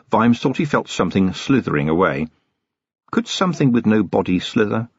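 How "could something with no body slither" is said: ordinary delivery, nothing marked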